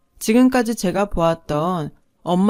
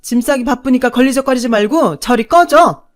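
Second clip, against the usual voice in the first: louder, hard, dismissive scolding; harsh cold telling-off; 3 semitones higher, 6 dB louder than usual. Acted. Mother coldly pushes daughter away, harsh and loud. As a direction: push her away with cruel words. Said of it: "모진 소리를 해댔죠".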